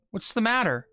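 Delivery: usual speaking voice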